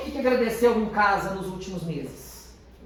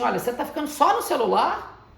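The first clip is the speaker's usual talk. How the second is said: louder, higher nagging mom; scolding mother; 5 semitones higher, 5 dB louder than usual. Retold or imitated. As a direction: question him like a suspicious mother. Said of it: "quando sua mãe falar assim". (mãe desconfiada, mais alta e mais forte)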